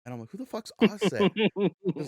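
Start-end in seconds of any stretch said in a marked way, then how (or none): 0.3-1.3 high, loud, incredulous question; baffled disbelief. Retold or imitated. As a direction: blurt it out, totally clueless. Retells their clueless reaction to friend.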